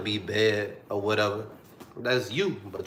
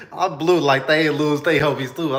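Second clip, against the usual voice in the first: louder, higher laughing self-mockery; laughing at himself; 4 semitones higher, 5 dB louder than usual. Real, not acted. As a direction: laugh at how dramatic he was.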